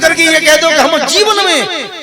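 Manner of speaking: loud, high exhortation; fervent preaching. Acted. Preacher urging the listeners to honour parents, fervent and loud. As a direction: preach it with full force.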